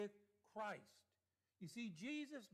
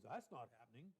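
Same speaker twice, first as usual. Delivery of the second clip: casual brush-off; 10 semitones lower, 6 dB quieter, faster than usual. Retold or imitated. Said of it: "one of those phrases".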